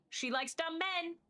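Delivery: ordinary talk, nothing marked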